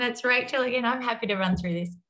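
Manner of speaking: usual speaking voice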